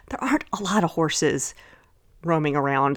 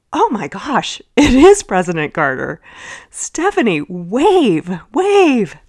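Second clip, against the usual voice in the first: thrilled mom, much louder and higher on the waves; excited mom; 4 semitones higher, 7 dB louder, slower than usual. Retold or imitated. Mom thrilled, urging her daughter to wave at Carter. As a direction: urge the kid to wave, breathless with excitement.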